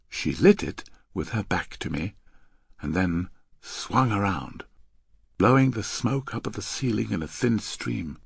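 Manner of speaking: ordinary delivery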